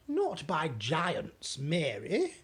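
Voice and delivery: slowed, measured royal reply; slow correction. Acted. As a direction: correct her slowly. Queen corrects slowly.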